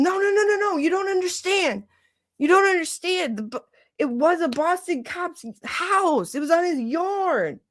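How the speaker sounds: high-pitched, insistent mock rant; frantic ranting. Re-enacting ranting to family about the case, frantic. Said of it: "ranting about it".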